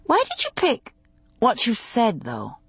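high-pitched curious question, dropping off at the end; lightly voiced woman's wondering question. A narrator's reading. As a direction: ask with open curiosity, starting high. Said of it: "she asked, curious".

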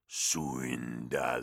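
low, slow coughing growl; growling withered god. Acted. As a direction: croak it like a dying god. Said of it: "growled the withered god".

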